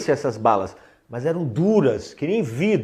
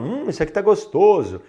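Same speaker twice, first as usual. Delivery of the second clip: savoring; 7 semitones higher, faster than usual. Retold or imitated. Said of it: "no português falam".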